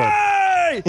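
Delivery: high screamed hey, imitating the band; mock scream. Retelling the band screaming on stage, mock scream. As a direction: yell it like a crazed frontman. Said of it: "screaming, going".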